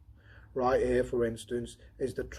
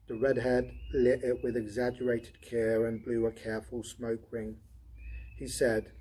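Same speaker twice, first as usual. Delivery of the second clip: ordinary talk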